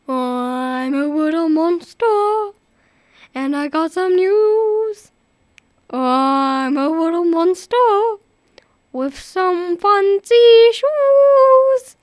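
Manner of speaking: slow sing-song monster voice. Acted. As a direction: croon a silly rhyme.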